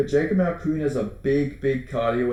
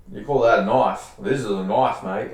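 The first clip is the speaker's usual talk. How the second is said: hammed-up Aussie accent, louder; Aussie movie quote; 3 semitones lower, 4 dB louder than usual. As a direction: deliver it like a tough Australian outback hero. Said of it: "really brought out the Aussie accent".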